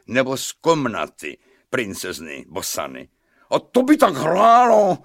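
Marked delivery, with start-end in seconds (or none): 3.5-5.0 high, loud outburst; indignant cry. A narrator's reading. King shouting from his bath, indignant cry. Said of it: "vykřikl Pikola ve vaně".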